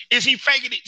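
incredulous. Retold or imitated. Doubting the actor's accent, high incredulous question. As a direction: ask it high, not believing it. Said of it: "I said".

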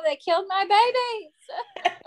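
high, slow mock wail; laughing lament. Real, not acted. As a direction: protest in mock grief through laughter. Mock outrage over dead characters.

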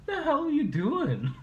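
laughing neighbor. Retold or imitated. Neighbor catches him at door.

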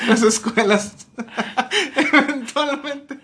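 high, slow, breaking into real laughter; cracking up between words; laughing through words. Real, not acted. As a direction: struggle to get the joke out while laughing. Cracking up at own dark joke.

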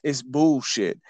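ordinary delivery

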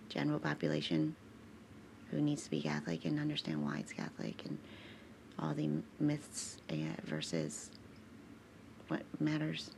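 low, soft muttering to herself; mumbled aside. Real, not acted. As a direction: mumble it under your breath. Long low aside about bills.

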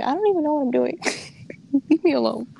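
panicked, laughing, begging to be left alone; frantic quote. Retold or imitated. To classmates asking for help, a frazzled laughing plea. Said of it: "I'm like".

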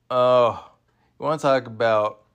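ordinary talk, nothing marked

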